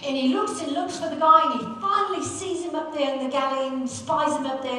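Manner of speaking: ordinary delivery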